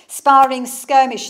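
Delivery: sparring skirmish burst out loud and slow. Acted. Reciting battle verse to the audience, sudden loud climax. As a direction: let it ring like clashing steel. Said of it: "But now listen to this".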